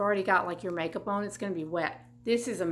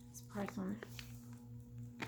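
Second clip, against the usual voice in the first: slow whispered question to herself; 17 dB quieter, much slower than usual. Real, not acted. Vlogger whispers to herself while reading a price tag.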